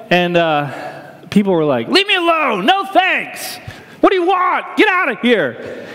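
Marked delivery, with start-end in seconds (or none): 1.9-5.9 high, mock-irritated locals waving them off; grumpy brush-off. Church team member mimicking locals brushing off gift cards, high and irritated. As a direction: play the gruff Northeasterner shooing strangers away.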